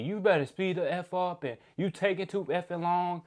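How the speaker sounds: lightly voiced angry complaint; mock cussing out. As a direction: imitate an irate coworker rushing you. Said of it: "starts cussing at me".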